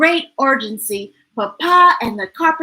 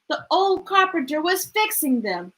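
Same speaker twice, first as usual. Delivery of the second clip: plain reading voice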